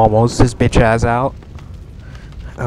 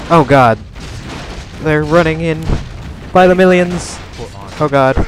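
alarmed outburst; 6 semitones higher than usual. Real, not acted. Enemies flooding in.

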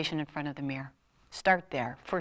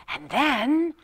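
higher, louder rising And then; mock suspenseful And then; 7 semitones higher, 5 dB louder than usual. Shown as a demonstration. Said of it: "and then you say".

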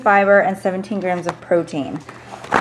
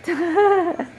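bright amused laugh; light laugh; 9 semitones higher than usual. Real, not acted. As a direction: laugh at your own joke. Laughs at own remark.

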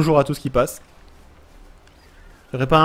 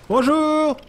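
high playful hello, slow at the end; hammed-up welcome; mock friendly greeting; 6 semitones higher, much slower than usual. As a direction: play the peaceful visitor. Greeting creatures peacefully.

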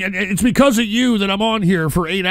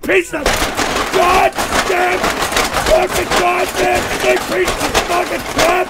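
disgusted angry shouting; 10 semitones higher than usual. Real, not acted. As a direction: scream it out in disgust. Disgusted by the clip he just watched.

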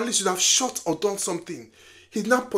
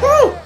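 very high, loud "woo"; short piercing whoop; excited whoop; 10 dB louder than usual. Real, not acted. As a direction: let out a sudden whoop. Excited reaction to film.

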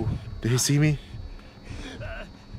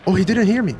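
excited; 7 semitones higher, 5 dB louder, much faster than usual. Real, not acted. Thrilled after sneaking past.

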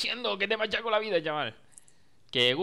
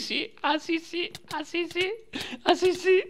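high squeal; slower than usual. Pretend. Mock high squeal while playing.

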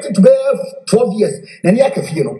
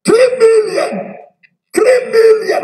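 stunned exclamation; 13 semitones higher, slower than usual. Real, not acted. Exclaims the huge sum.

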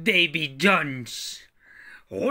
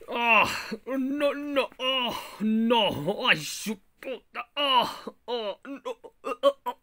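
mock pain cries; 6 semitones higher, slower than usual. Wounded pirate writhing.

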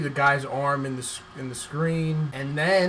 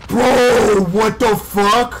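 loud, high, slow outburst; outraged yell; 6 semitones higher, 10 dB louder, much slower than usual. Real, not acted. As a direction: let the outrage explode. Outraged at killer.

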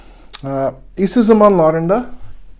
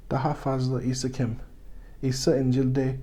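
normal talking voice

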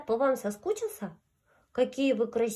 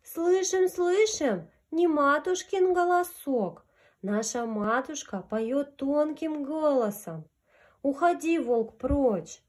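little goats' voice; 4 semitones higher, slower than usual. Acted. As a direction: chirp it out like little kids. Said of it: "А козлятки отвечают".

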